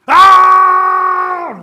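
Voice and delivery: long, high, very loud shriek; full-out scream of panic; terrified scream. Acted. Turtle fleeing Thunder's lightning strike, screaming. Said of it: "Runs and screams".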